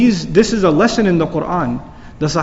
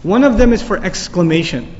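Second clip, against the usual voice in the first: ordinary talk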